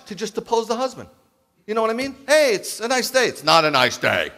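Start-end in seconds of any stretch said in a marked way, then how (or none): 2.3-3.3 higher and brighter, a light mock-cheery greeting; cheerful husband. Husband voicing a cheerful remark. 3.3-4.3 lower, with stress on "not", a mock contradiction; contrary wife. Wife contradicting him flatly.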